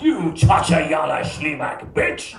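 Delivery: slow, hammed-up fake Polish snarl; mock Polish gibberish. Jogger insulting a dog owner in fake Polish, slow snarl. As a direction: spit out fake Polish slowly and menacingly. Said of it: "should have really freaked him out and went".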